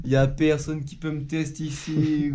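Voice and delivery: slow hype intro. Real, not acted. Triumphant vlog return intro.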